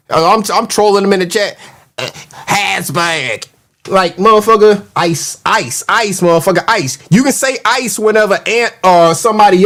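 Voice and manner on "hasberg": slow, soft mock "Hasbag"; mocking mispronunciation. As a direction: drag out "Hasbag" with an H and a Z sound in front. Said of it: "but you say Hasberg".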